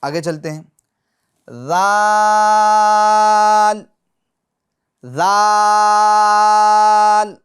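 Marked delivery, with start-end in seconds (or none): 1.7-7.3 ذال with the tongue tip at the upper teeth, loud and long; drawn-out recited zaal. Shown as a demonstration. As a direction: stretch ذال slowly with the tongue between the teeth. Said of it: "اسی طریقے سے یہ حرف کو بھی ادا کریں گے". (ذال کو زبان کا سرا دانتوں سے لگا کر لمبا پڑھیں)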